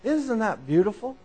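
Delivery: ordinary delivery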